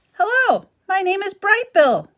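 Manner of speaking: high, loud call over waves; shouting. A narrator's reading. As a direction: shout it out across the water. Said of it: "he shouted over the waves".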